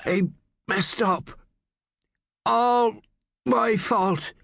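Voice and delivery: high, halting, injured speech; feeble, broken confession; very weak voice. A narrator's reading. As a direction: gasp it out weakly with long breaks. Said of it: "Poole's voice was very weak".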